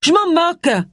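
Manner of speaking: colère jouée, très aiguë; s'emporte soudain, voix très haut perchée; emportement. A narrator's reading. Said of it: "s'emporta Allison". (emportée, dans l'aigu)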